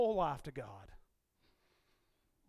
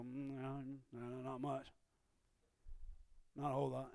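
much softer, low mumble; husband's mumbled brush-off; 4 semitones lower, 9 dB quieter than usual. Retold or imitated. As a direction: shrug her off under your breath. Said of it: "And you go".

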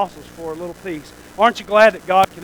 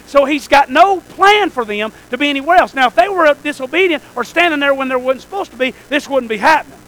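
raised, higher pulpit voice; forceful preaching; 7 semitones higher, 12 dB louder than usual. Real, not acted. Preacher insisting the apostles kept obeying God, forceful and raised. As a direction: drive the point home to the congregation, loud and pressing.

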